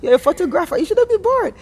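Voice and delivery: high, bright mock scold; playful scolding. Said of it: "And I'm like".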